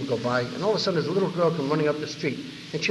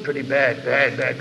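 BAD dropped down in pitch; lowered voice; 2 semitones lower, slower than usual. Shown as a demonstration. Answering how are you, with a lowered voice. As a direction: let each BAD sink below the upbeat answer. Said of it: "merely raise or lower your voice".

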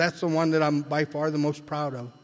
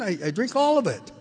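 higher, louder, fed-up insistence; exasperated answer; 7 semitones higher, 4 dB louder than usual. Retold or imitated. Patient repeats his answer to the psychiatrist, louder and exasperated. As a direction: throw the same answer back at him. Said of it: "didn't you listen?".

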